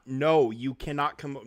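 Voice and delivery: loud, stern no to the dog. Real, not acted. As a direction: shut the dog down, loudly. Scolding dog off his lap.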